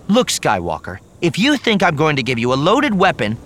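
high, loud, heated protest; angry refusal. A narrator's reading. Mara refusing Luke a loaded weapon, angry and indignant. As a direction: burst out indignantly, pitch climbing.

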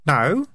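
"No" pitched high, as if asking why; high, puzzled "No"; questioning no. Acted. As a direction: say "No" warily, meaning why do you ask. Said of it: "the kind of no that means, Why are you asking".

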